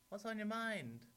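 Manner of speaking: put-on high, loud obliging storybook girl. A narrator's reading.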